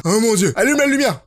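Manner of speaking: ordinary talk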